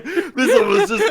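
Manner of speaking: loud, high, slow whine through laughter; hammed-up blubbering, half laughing; mock wail.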